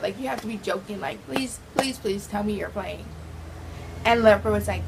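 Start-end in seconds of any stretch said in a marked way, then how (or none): 0.0-3.0 hushed, softer begging please; pleading whisper. Retold or imitated. Girl begging her crush to say it was a joke, hushed. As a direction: beg quietly, half whispering.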